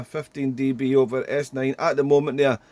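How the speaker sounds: normal talking voice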